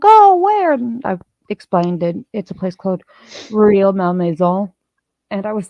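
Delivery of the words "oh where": much higher and louder; bright curious gasp of the colleagues; mock eager question. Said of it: "And they're like".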